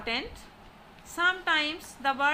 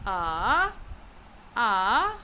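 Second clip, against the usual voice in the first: "A" pushed low, then let go upward; each "a" dips and swings up; falling-rising tone on "a"; third tone; 5 semitones lower than usual. Shown as a demonstration. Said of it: "pushing the tone and then letting go".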